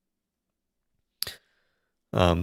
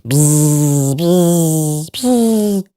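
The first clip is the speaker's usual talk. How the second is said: rising bee buzz; 6 semitones higher, 3 dB louder, much slower than usual. Retold or imitated. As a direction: buzz climbing higher each time. Swarm pursuit sound effect.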